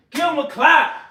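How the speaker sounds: high, hyped-up cheer; excited. Real, not acted. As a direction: cheer yourself on. Cheering himself after push-ups.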